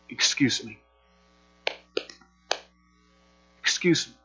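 soft, meek little excuse me; timid polite excuse. Shown as a demonstration. Showing how Jesus did not cry out, meek and quiet. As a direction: ask so quietly nobody hears. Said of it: "This is what Jesus did not do".

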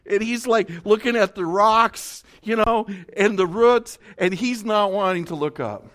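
loud, driving sermon voice building up; full-force preaching. Acted. Pastor telling the mountain climb, preaching hard. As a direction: pound the story home from the pulpit.

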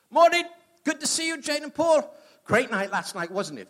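high, loud, overly cheerful; mock cheery brother. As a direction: play it bright as if nothing happened. Brother cheerfully ignoring last night.

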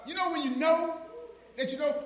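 high, loud shouted sermon; preaching shout. Acted. Preacher defying the devil before his congregation, shouting. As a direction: thunder it at the devil.

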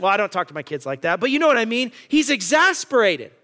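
ordinary talk

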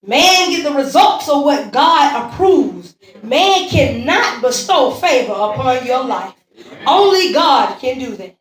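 loud, declarative sermon delivery; emphatic preaching. Real, not acted. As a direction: proclaim it with full conviction. Preaches at full force.

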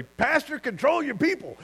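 imagined complaint. Retold or imitated. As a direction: pitch it up as a whiny accuser. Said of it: "I was waiting for him to say".

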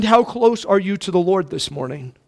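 ordinary talk, nothing marked

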